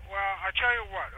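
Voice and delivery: usual speaking voice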